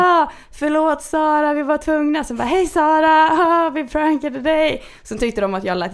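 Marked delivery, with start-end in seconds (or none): 0.0-2.2 high, slow, mock-apologetic boy voice; sheepish prankster. Prankster apologising to Sara on speakerphone, high and drawn out. 2.4-4.8 high, slow, teasing reveal; gleeful prankster. Retold or imitated. Pranksters on speaker telling Sara she was fooled, high and teasing.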